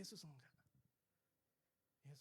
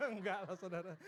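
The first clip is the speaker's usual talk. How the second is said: louder, laughing through the words; real laughing; 4 semitones higher, 6 dB louder than usual. Real, not acted. Pastor taking back a joke to congregation, laughing.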